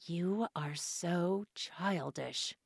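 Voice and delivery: slow, soft annoyed hiss. A narrator's reading. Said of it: "I hissed".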